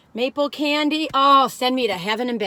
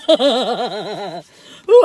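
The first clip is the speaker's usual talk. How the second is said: evil laugh; 3 semitones lower, 5 dB louder than usual. Acted. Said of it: "I sound evil".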